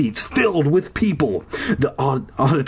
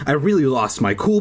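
usual speaking voice

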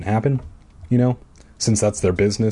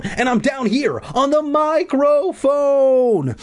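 high, long-drawn mock holler; calling out; much slower than usual. A narrator's reading. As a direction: stretch the last word into a hammed-up call. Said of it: "I called out".

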